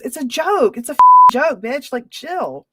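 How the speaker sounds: loud and high, fed up; exasperated shout. Real, not acted. Host snapping at critics about her flex clip, loud exasperated shout. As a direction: yell it's just a joke. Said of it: "defending the flex video".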